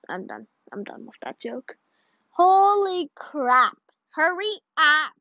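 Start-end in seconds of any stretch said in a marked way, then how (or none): 2.3-5.1 louder, higher and slower, pushing the game to hurry; frustrated call to the slow loading; impatient loud urging. Real, not acted. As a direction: yell at the screen to hurry up.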